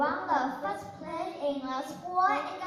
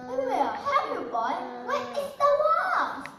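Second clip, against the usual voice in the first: villagers calling; 9 semitones higher, 9 dB louder than usual. Acted. Villagers rush in to help.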